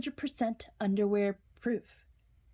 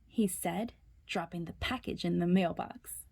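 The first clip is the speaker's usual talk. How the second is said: ordinary delivery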